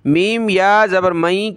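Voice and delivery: ordinary delivery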